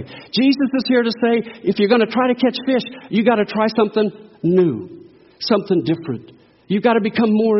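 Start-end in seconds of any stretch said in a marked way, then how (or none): none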